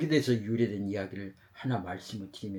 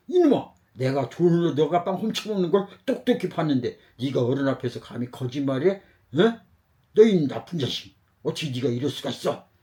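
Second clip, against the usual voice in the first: loud, pitched-up outburst at the start; angry scolding; 3 semitones higher, 6 dB louder than usual. Acted. Bakery owner scolds the boy for lying, angry. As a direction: scold a child caught lying. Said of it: "그러면서 막 야단치는데".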